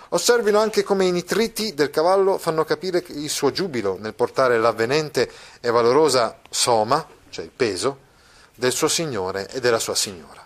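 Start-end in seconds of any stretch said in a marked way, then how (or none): 0.1-7.1 lively reading voice, raised pitch; read aloud. Acted. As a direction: reads the passage to the class. (lettura ad alta voce vivace)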